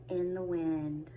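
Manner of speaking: ordinary delivery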